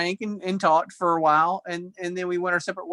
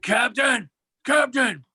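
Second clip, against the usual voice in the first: hammed-up superhero shout, pitched up; 6 semitones higher, 3 dB louder, slower than usual. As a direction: shout it as a mock masked vigilante.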